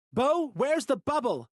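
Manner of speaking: high, loud, slow panicked demand; frantic shout for answers. Acted. Alarm continues.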